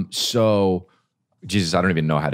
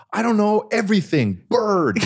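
put-on much higher, whiny child voice; scared little kid; 13 semitones higher than usual. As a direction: squeak it out like a frightened kid. Scared kid answering his dad.

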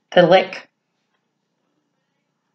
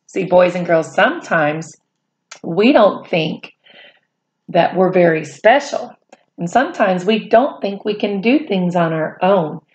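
ordinary delivery

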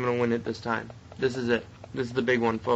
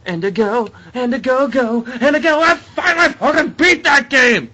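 high, loud cheer building to a yell; triumphant shouting; 12 semitones higher, 9 dB louder than usual. Real, not acted. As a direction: explode with relief at winning. Finally beats the game.

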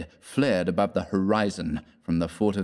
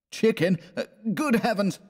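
much higher, dismayed moaning voice; 13 semitones higher than usual. Acted. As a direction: wail it in dismay. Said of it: "moaned Fallowby".

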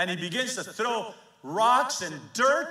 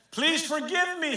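higher, urgent plea for mercy; pleading; 11 semitones higher, 5 dB louder than usual. Retold or imitated. Shimei begging the king's forgiveness, pleading. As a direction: beg forgiveness, a lightly voiced plea. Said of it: "he pleaded".

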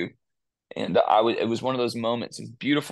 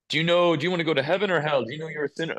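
pushy preacher; 6 semitones higher, much faster than usual. Retold or imitated. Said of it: "I used to think".

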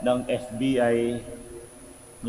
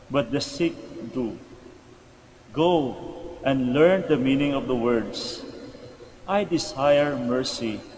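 slow, measured scripture reading; Gospel reading; slower than usual. Acted. Priest reads the Gospel to the congregation, solemn reading. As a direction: read scripture out in deliberate phrases.

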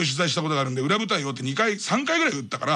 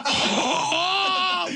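frightened gasp; 10 semitones higher than usual. Retold or imitated. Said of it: "顔面蒼白で怯えてるのよ".

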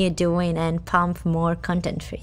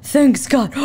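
ordinary delivery

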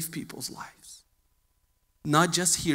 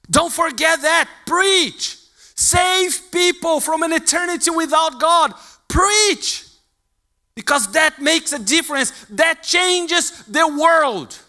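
much higher and louder, shouted command; preaching shout; 12 semitones higher, 11 dB louder than usual. Acted. Preacher charging the church to preach, shouting. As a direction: shout it like a battle cry from the pulpit.

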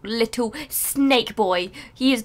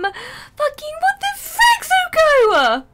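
outraged wail, much higher and slow; so mad; 3 dB louder, much slower than usual. Real, not acted. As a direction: drag it out in furious disbelief. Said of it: "I'm so mad".

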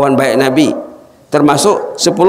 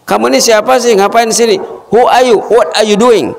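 high, sharp startled questions; the father demanding who this is; 9 semitones higher than usual. Acted. Old father in the dark demanding who she is, high and startled.

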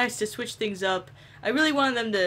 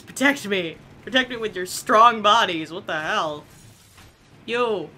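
ordinary delivery

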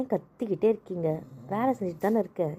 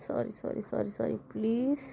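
much softer, slow pleading; soft pleading sorry; 6 dB quieter, slower than usual. Acted. Wife softly begs her husband's pardon, slowly. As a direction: beg quietly to be let off.

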